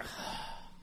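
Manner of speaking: mock group sigh of exasperation; exasperated sigh. Family held up at the door, exasperated sigh. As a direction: let out a heavy, frustrated breath. Everyone's reaction to the delay.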